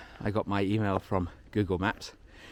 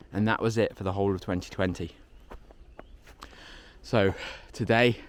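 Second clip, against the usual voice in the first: ordinary talk, nothing marked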